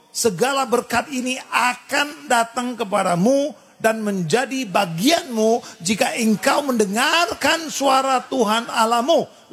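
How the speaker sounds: usual speaking voice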